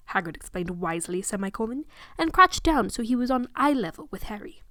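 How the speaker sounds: usual speaking voice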